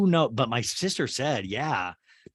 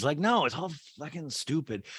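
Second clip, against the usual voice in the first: ordinary talk, nothing marked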